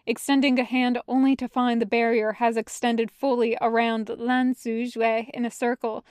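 plain reading voice

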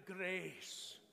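"grace" with a rolled R, drawn out. Shown as a demonstration. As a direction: roll the R hard in "grace". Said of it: "So roll that R".

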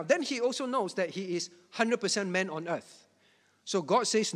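ordinary talk

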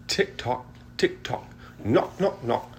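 ordinary delivery

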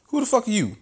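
high indignant yelp at an aide; Trump snapping. Acted. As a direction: sneer it way up high.

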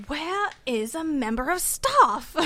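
slow, drawn-out posh British imitation; posh British accent. Housemate asking in a shop for help, posh British accent. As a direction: put on a posh British voice, stretching the words. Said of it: "the posh British guy".

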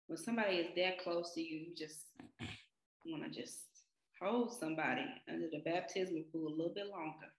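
low, soft and fast, deadpan; quiet joke. Acted. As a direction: slip the dark joke in under the breath. Joking about drowning someone.

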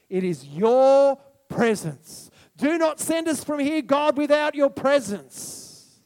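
earnest plea. Acted. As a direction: beg him not to leave, slowly. Moses pleading with God.

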